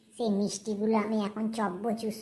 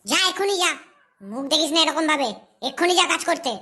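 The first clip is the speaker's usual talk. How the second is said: high, loud, cross order; angry scolding; 4 semitones higher, 6 dB louder than usual. Acted. Aunt ordering the girl off to do chores, angry scolding. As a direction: scold sharply, voice raised.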